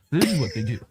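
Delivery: lightly voiced ostrich mating call; a hint of a strutting ostrich. Shown as a demonstration. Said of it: "the only other ostrich impression you have to learn is their mating dance".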